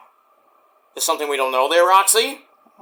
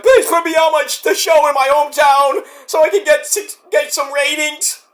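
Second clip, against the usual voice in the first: mock begging whine; 9 semitones higher than usual.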